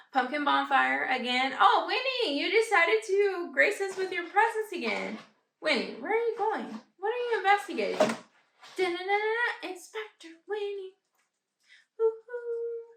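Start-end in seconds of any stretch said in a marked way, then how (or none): 1.6-12.9 high, playful doting voice; singsong, high-pitched fussing; pet talk. Real, not acted. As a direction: coo teasingly at a wandering pet. Baby-talks to her visiting pet.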